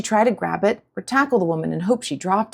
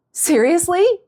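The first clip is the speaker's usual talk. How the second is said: incredulous; 11 semitones higher, 5 dB louder, slower than usual. Acted. Girl stunned by acrobatics.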